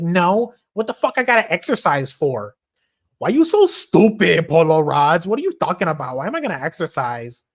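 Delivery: high, loud, sassy woman voice; torta woman impression. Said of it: "my fucking torta impression".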